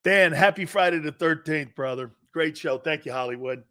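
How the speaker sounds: ordinary talk, nothing marked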